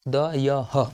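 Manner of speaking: slow dictation. Shown as a demonstration. Teacher showing students the added ह, slow dictation. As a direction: drag out महोदय ह, very slowly and evenly. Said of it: "जिसमें खाली ह जोड़ दे".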